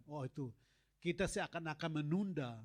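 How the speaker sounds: usual speaking voice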